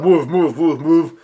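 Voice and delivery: lightly mimicked urgent yell; mild retold yell. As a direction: imitate someone yelling, without going full volume. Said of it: "Luffy yells out".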